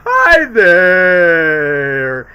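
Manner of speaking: loud, long drawn-out hello; cheery mock greeting. [name] greets friends, hammed-up hello. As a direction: stretch the greeting out big. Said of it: "[name] just goes".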